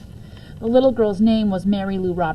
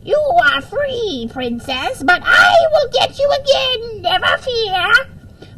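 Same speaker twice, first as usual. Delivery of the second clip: high, slow screeching witch, very loud; 13 semitones higher, 4 dB louder, slower than usual. Pretend. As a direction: cackle it out like a hammed-up witch. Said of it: "screeched out".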